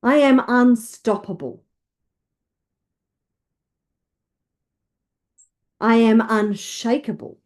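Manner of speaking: emphatic, declarative affirmations; rousing self-belief, leading others to repeat; with conviction. Shown as a demonstration. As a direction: declare each one like you mean it. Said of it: "Say it with conviction".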